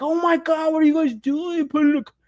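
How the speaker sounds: high, mocking voice of a shocked collector; hammed-up fan outrage; mock outraged fan. Mocking fans who'd complain to Hasbro.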